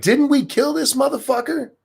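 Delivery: high, squeaky mock-outraged reporter voice; mocking the press. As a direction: shriek it like a shocked journalist. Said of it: "They're like".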